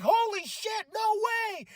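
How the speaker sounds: very high yell of disbelief; shocked shout. Retold or imitated. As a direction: scream in amazement. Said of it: "I was like".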